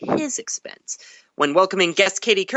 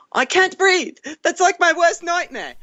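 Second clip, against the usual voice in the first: high mock British accent; 11 semitones higher, 3 dB louder than usual. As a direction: redo the quote in a put-on British accent, pitched well up. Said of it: "I should put in my British accent".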